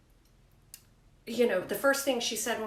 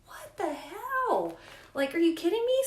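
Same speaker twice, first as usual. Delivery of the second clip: outraged disbelief; 9 semitones higher, 5 dB louder than usual. Retold or imitated. Mother retelling her shock at the nurse, outraged disbelief. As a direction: sound floored by the news.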